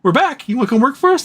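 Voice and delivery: fake-friendly company rep luring them back; high sing-song sales voice; mock cheery recruiter. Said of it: "to be like".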